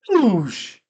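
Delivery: loud, high whooshing noise; imitated arrow whoosh. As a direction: whoosh like an arrow loosed from a bow.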